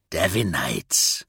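low, slow character voice. A narrator's reading. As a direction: drop low and draw the word out. Kurz's voice within narrated story.

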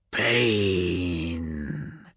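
low, soft, drawn-out villain's hiss. A narrator's reading. Slaver lord aims his gauntlet at Vulcan, hissing. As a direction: hiss the word slowly and softly. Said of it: "he hissed".

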